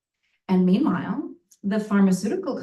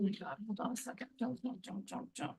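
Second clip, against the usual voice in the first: under her breath; 17 dB quieter, faster than usual. Real, not acted. Stops laughing audience mid-set.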